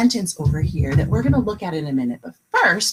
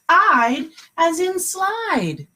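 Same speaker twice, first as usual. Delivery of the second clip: excited slow model; 9 semitones higher, much slower than usual. Shown as a demonstration. Teacher models the word pattern.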